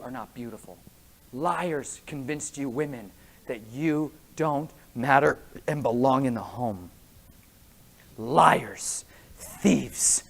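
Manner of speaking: low grave hush; hushed preaching. Acted. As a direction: lean in and warn quietly. Drops low and soft.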